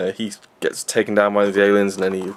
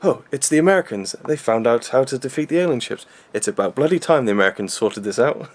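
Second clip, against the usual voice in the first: mock British accent, higher; a lightly hammed English voice; British blokes grumbling, British accent; 4 semitones higher than usual. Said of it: "British guys going".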